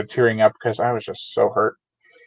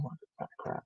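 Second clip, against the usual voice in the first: whisper; 22 dB quieter than usual. Real, not acted. Admiring a creepy book cover.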